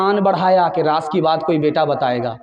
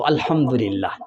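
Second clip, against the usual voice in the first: lower, softer, satisfied murmur; content murmur; 8 semitones lower, 4 dB quieter than usual. Acted. Daughter telling her mother about her in-laws, soft and content. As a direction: answer it quietly, pleased.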